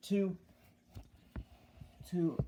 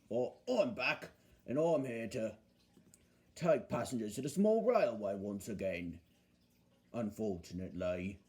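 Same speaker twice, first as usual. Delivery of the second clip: low, grudging bus character; Bulgy; 7 semitones lower, 3 dB quieter than usual. Acted. Bulgy telling Duck he's back for railway duty, low and grudging. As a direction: sink lower, resigned to the job. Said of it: "said Bulgy".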